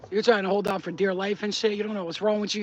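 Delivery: ordinary delivery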